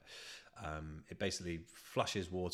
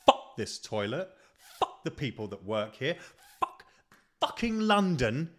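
mock rant; 5 semitones higher, 7 dB louder than usual. Acted rant at London toilet.

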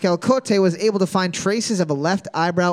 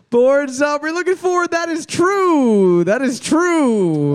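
game show answer reveal; 6 semitones higher, 4 dB louder than usual. Pretend. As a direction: stretch the last word out long and high. Host announces the answer with drawn-out flourish.

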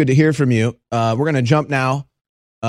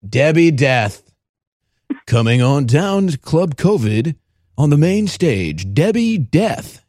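slow, drawn-out club announcer; hammed-up strip club emcee; mock announcer; slower than usual. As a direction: announce her like a stripper onstage.

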